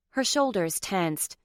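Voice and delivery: ordinary delivery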